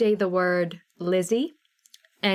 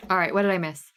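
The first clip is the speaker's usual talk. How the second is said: ordinary delivery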